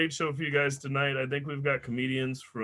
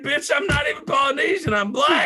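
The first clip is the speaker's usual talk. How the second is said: loud, high-pitched indignant comeback; mock-outraged raised voice; indignant retort; 12 semitones higher, 8 dB louder than usual. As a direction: shout it back, acting offended. Indignant retort to lady in story.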